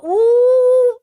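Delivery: loud, high, drawn-out "ooh"; worked-up high "ooh"; outraged "ooh". Real, not acted. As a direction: let out a sustained high hoot of outrage. Outraged reaction to hijab story.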